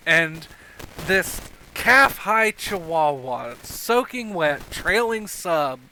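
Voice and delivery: loud, animated storytelling with stretched words; game master's scene setting. Acted.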